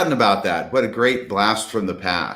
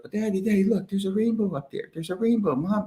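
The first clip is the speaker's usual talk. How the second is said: put-on much higher, soft child voice; little kid voice; 9 semitones higher, 7 dB quieter than usual. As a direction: play the small child spotting the rainbow.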